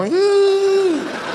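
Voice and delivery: long, high, steady wail; thin sustained put-on keening; mock mourning cry. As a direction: keep wailing on and on out of habit. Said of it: "the cry of mourning".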